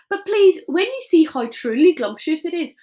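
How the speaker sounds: a giant tempting a bully to eat; eager pleading. Acted. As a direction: plead sweetly with the brute.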